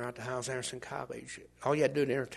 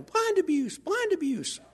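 much higher, chanted protest; mock outraged yelling; 5 dB louder, slower than usual. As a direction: chant it like indignant social workers. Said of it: "they'd have been yelling".

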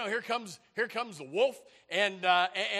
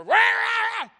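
loud, high mock roar; enemies' roar; 17 dB louder, slower than usual. Enemies roaring at the dinner table, loud acted roar. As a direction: let out a huge hammed-up roar. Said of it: "And the enemies roar".

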